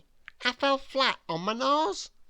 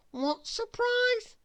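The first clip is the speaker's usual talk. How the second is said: high, slow and eager; 13 semitones higher, slower than usual. A narrator's reading. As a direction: ask it eagerly, pitched way up. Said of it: "I said eagerly".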